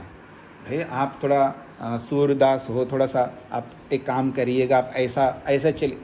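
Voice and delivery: low, gentle, courteous request; loving, considerate. Shown as a demonstration. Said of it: "कुछ उसको इतना प्रेम से बोलो".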